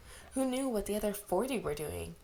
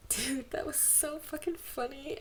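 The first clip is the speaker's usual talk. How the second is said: amused; 7 semitones higher than usual. Real, not acted. Reader cracking up after fic.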